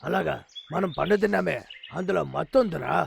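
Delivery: just waking from a drugged sleep; groggy, drugged. Acted.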